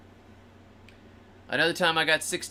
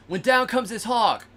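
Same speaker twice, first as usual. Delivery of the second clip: ordinary talk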